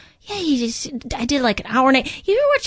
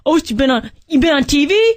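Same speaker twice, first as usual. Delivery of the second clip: louder, higher, gawking mock fan; impressed fan; 4 semitones higher, 5 dB louder than usual. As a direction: ham up starstruck fans. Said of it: "and people go".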